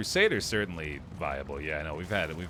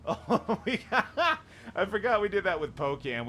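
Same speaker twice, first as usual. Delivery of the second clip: laughing; 13 semitones higher, 4 dB louder than usual. Real, not acted. Laughing while greeting dog.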